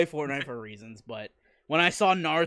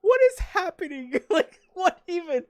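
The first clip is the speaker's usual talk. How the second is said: bewildered; 14 semitones higher, 5 dB louder than usual. Retold or imitated. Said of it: "And I'm like".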